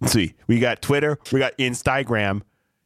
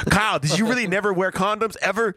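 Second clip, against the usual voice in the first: higher-pitched mock listener voice; playful, raised-pitch question; 9 semitones higher than usual. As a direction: lightly act out a nosy fan's question. Acting out a listener's emailed question.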